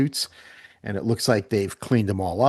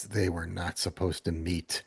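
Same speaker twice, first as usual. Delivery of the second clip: understated aside; 3 semitones lower, 9 dB quieter than usual. A narrator's reading. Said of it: "looks down and says".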